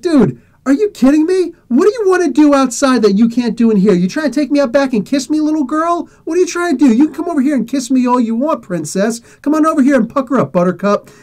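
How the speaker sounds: high-pitched incredulous goading; retold heated, teasing comeback; mocking taunt. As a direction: belittle him with sneering pet names. Acted mocking comeback to the kid.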